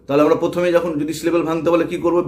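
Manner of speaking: normal talking voice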